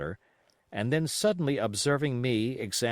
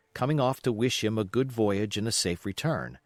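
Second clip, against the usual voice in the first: plain reading voice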